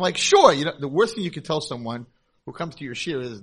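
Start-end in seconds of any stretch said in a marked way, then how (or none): 0.2-0.5 fake cheerful sure. Rabbi fakes remembering.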